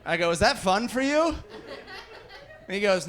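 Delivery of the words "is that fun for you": higher-pitched mock disbelief; incredulous. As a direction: ask it appalled, voice lifting high. Acted challenge to the dealer.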